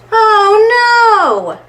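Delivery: high, loud oh no; dismayed exclaim. Acted. Reading to children, cries out at the mess, high and loud. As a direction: gasp it out for the kids.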